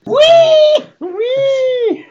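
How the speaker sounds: high mock squealing whee; pig squeal. Mocking squealing pig joke.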